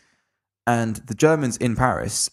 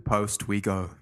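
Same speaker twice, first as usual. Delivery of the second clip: slow, softer recited sign-off; sign-off verse; 6 dB quieter, slower than usual. Acted. A podcast host closing the show, slow soft recited verse.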